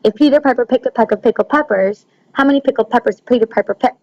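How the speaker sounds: a brisk run through the P words; tongue twister. Shown as a demonstration. Teacher reads a tongue twister aloud to her class, briskly. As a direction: rattle it off quickly like a tongue twister. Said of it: "kind of like a tongue twister".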